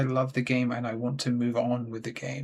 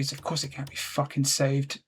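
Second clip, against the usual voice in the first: normal talking voice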